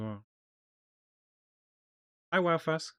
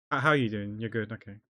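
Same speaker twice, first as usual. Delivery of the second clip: usual speaking voice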